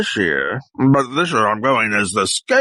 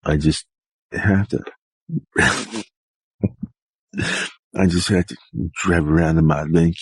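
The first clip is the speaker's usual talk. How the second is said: low character voice; 8 semitones lower than usual. Acted. Low put-on character voice bits.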